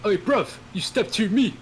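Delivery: put-on dumb British guy accent. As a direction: puff up like a cartoon British thug. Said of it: "the dumb British guy accent".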